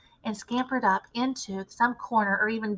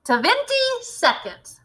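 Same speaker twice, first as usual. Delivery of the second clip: much higher, slow cry; 13 semitones higher, 4 dB louder, much slower than usual. A narrator's reading. Witch cries the countdown, high and slow. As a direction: stretch it out triumphantly. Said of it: "cried the Grand High Witch".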